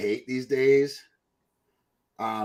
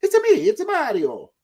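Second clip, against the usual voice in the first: very high cartoon Italian accent; squeaky, playful Italian-accented impression; Mario; 4 dB louder than usual. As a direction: do the famous game plumber's high voice. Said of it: "everybody knows that voice".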